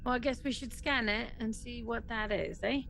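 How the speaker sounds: normal talking voice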